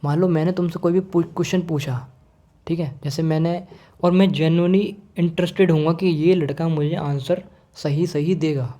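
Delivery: normal talking voice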